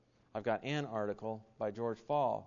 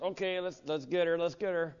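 higher, playful mock eagerness to pounce; itching to pounce; 6 semitones higher, 5 dB louder than usual. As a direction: tease it like you're itching for a debate fight. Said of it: "the part of me is just".

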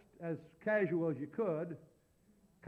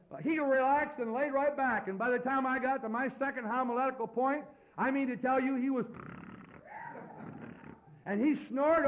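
high-pitched, energetic pulpit storytelling; raised, driving sermon anecdote; animated preaching; 8 semitones higher, 7 dB louder than usual. Real, not acted. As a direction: tell it big to a full congregation.

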